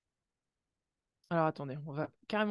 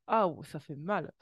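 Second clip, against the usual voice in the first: louder wincing ah oh; pained surprise; 5 dB louder than usual. Real, not acted. Reader wincing at the card's message, louder. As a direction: say it like the truth stings. Card reveal shocks reader.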